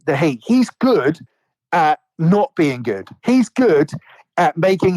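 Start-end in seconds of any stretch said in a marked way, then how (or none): 0.4-1.2 He's jumps high with a falling end; stress on He's. Real, not acted. Hosts arguing about a wrestler, He's stressed high. 3.2-3.9 He's jumps high with a falling end; stress on He's. Real, not acted. Hosts arguing about a wrestler, He's stressed high.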